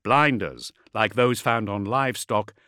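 ordinary delivery

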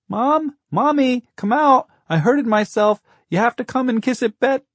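put-on very high, loud child's cry; small boy's piercing plea; wailing little boy. A narrator's reading. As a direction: call out for Mommy, hurt and needy. Narrator voices hurt little boy calling.

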